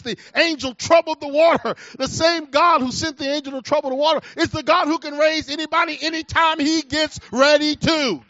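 loud, high, rising preacher's cry; fiery gospel preaching; preaching at full force. Acted. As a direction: build the congregation up to a shout.

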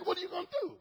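soft, high-pitched mocking dare; taunting. Man talking to God, taunting. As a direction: pitch it up like a casual challenge. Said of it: "he began to taunt the Lord".

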